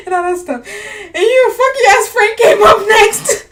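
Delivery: high, loud, laughing-crying outburst; laughing rant. Real, not acted. Telling a friend about Frank, high and laughing. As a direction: squeal it out through laughter.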